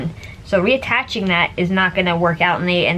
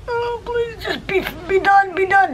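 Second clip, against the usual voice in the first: much higher whimpering plea, slow at first; whiny plea; 12 semitones higher than usual. Retold or imitated. Patient enduring mom lifting her port, high whimpering plea. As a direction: squeak out a plea for it to be over. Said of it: "I'm always going like".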